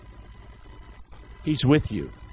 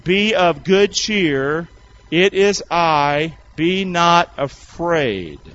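slow group recitation; much slower than usual. Shown as a demonstration. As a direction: lead the congregation word by word, slowly. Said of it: "Can we do that one more time".

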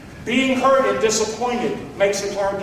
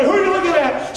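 jealous husband; 7 semitones higher than usual. Retold or imitated. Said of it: "I became jealous".

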